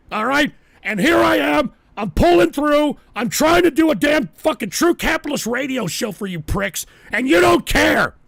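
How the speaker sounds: loud, high, enraged shout at the trolls; furious yelling. Real, not acted. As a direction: tear into the trolls at full volume. Raging at trolls during show.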